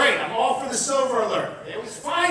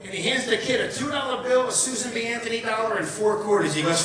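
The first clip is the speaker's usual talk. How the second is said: ordinary talk, nothing marked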